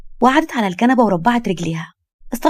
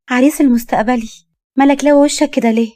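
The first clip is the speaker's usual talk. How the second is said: higher, louder playful greeting; teasing young woman; 4 semitones higher, 4 dB louder than usual. Acted. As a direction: cheerfully needle him. Young woman teases her future groom.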